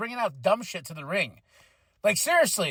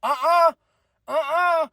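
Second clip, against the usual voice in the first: slow, higher, drawn-out hell no; mock crowd; 6 semitones higher, 3 dB louder, slower than usual. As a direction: imitate a disapproving crowd. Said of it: "That crowd was like".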